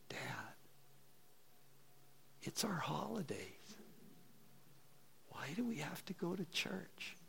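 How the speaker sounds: small, hushed voice imitating a reluctant daughter; child's quiet complaint. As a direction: murmur it like a kid pleading with dad.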